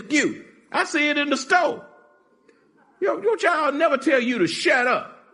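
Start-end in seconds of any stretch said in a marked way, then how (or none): 3.0-5.3 indignant shout. Real, not acted. Preacher scolds tolerant parents.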